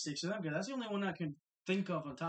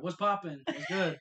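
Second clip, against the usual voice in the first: hype greeting; 7 dB louder, slower than usual. Real, not acted.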